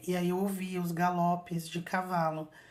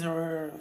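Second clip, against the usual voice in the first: imitated dog growl. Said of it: "que nem um cachorro".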